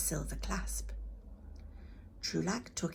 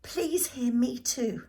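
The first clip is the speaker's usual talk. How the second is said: higher, slow, earnest plea; pleading; 7 semitones higher, 6 dB louder, slower than usual. Acted. Boy on his knees begging the goddess to hear him, pleading. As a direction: beg her to listen.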